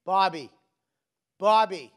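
loud falling calls of Bobby, Bobby, Bobby; mother summoning her son; mom calling out. Retold or imitated. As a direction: call him like a mother from downstairs. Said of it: "she'd go".

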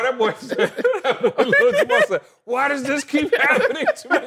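high squeaky laughing imitation of a kid; giggling mock kid voice. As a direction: laugh through the kid's complaint. Laughing retelling of son's line.

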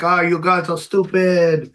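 light put-on French accent; mock French-accented quote, a bit slowed. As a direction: retell it with a half-hearted French accent. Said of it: "I don't know why I'm doing the French accent".

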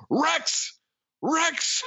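high-pitched imitation of a scream; mock scream. Charlie calling into the house to check who's home, mock scream. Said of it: "Charlie just screams".

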